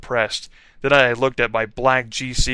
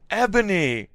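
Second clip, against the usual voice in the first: sad shout; 10 semitones higher than usual. A narrator's reading. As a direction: call her back in despair. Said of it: "shouted Draco sadly".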